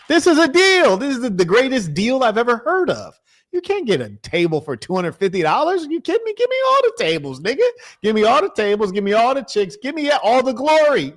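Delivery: excited inner voice. Retold or imitated. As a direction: squeal it like you just hit the jackpot. And in my head, I'm like.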